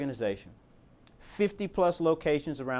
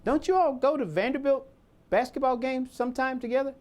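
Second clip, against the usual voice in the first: higher-pitched, imitated colleague asking; colleague's pointed query; 8 semitones higher than usual. Colleague asks the benefits person, pointedly curious. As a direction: ask it like you've just caught on.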